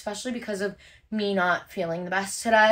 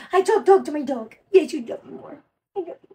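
put-on slightly higher baby-talk demo; dog talk; 3 semitones higher than usual. Shown as a demonstration.

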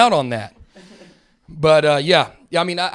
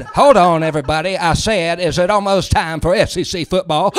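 louder, called out to everyone; loud announcement; 6 dB louder than usual. Retold or imitated. Comic playing himself yelling to his neighbors, loud. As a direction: call out over the crowd.

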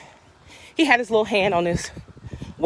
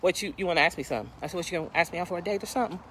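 teasing; 9 dB quieter, much faster than usual. Retold or imitated. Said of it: "Let me lighten my spirit".

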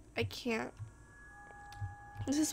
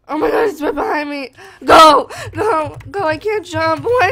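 fast, much louder frantic yells; real panicked shouting; 3 semitones higher, 18 dB louder than usual. Real, not acted. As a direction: yell at yourself to run in real panic. Fleeing monster in game.

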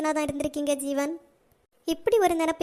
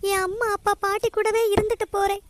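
higher-pitched, anguished begging for forgiveness; tearful pleading; 4 semitones higher than usual. Acted. Girl begging her family to forgive her, tearful pleading. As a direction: plead through sobs, voice raised in pitch.

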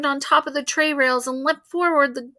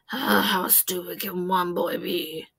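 lower, softer grumbling; muttering secretary; 5 semitones lower, 5 dB quieter than usual. A narrator's reading. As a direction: grumble it under your breath.

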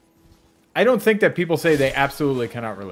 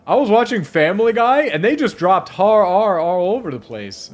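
imitating Linus; 9 semitones higher, 7 dB louder than usual. Voicing Linus retelling.

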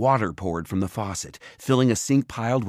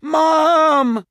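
much higher, much louder shout; calling upstairs; 10 dB louder than usual. A narrator's reading. Simon shouts up the stairs for his mother, high and loud. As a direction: yell it up the stairwell. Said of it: "shouting up to the next landing".

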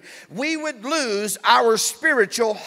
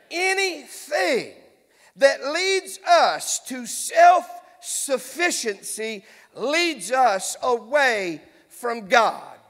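very slow, drawn-out key point; deliberate, weighty repetition at half speed; slow emphatic preaching; much slower than usual. Real, not acted. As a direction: stretch each phrase so it sinks in. Repeats key point for congregation.

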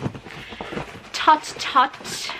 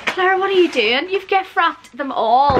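amazed; 6 semitones higher, 4 dB louder than usual. Real, not acted. Amazed at gift wrapping.

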